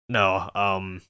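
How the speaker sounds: ordinary delivery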